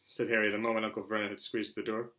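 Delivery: ordinary delivery, nothing marked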